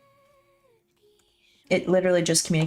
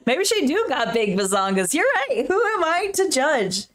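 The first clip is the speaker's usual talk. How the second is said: mock concession; 7 semitones higher than usual. Joking concession about drawn grandma.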